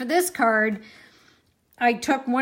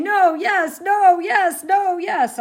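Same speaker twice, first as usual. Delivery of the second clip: mock chorus of comments; 7 semitones higher, 6 dB louder, slower than usual. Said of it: "Everyone's saying".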